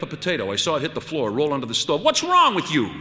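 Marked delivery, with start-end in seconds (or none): 2.0-3.0 much higher and loud, incredulous; outraged shriek. Acted. Wife appalled by her husband's question, shrieking. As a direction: shriek it in disbelief.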